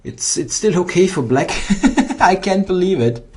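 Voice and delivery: high laughing cry; laughing disbelief. Real, not acted. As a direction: laugh it out, unable to believe it.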